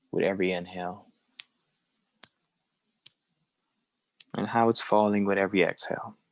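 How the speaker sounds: unhurried phrases with very long silences; guided meditation. Acted. Teacher leading a group through belly breathing, guided meditation. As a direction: guide each breath, leaving room to follow.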